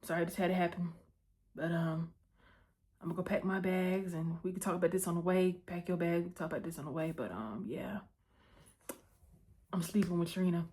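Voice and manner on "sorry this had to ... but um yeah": quiet apology. Retold or imitated. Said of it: "He like".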